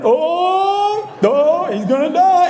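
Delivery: high, slow hammed-up alarm; mock panic from below. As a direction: shriek like a friend watching in fake horror.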